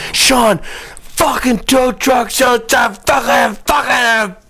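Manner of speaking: high, drawn-out shouted cursing, retold; so pissed. Man yelling at Sean over the tow truck, mock furious shout. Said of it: "he was so pissed".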